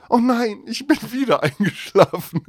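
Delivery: waking up and realising it's too late. Retold or imitated. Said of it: "denk so".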